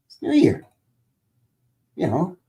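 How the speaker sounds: squeaky high cartoon voice; high goofy voice. Shown as a demonstration. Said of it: "goofy voices".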